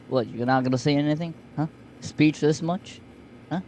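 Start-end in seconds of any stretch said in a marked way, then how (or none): none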